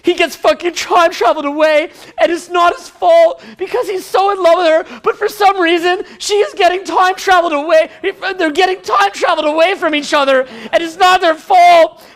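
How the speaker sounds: high-pitched emotional recap, building to a wail; mock distraught. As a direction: get choked up over the plot. Building mock-weepy retelling of film.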